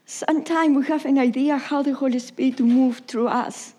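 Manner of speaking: ordinary talk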